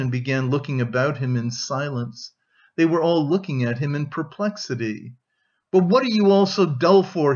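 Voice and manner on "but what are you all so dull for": louder, higher outburst at the whole room; sudden shout. A narrator's reading. Man breaking the awkward silence of his family, shouting suddenly. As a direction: burst out at them all. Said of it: "he shouted".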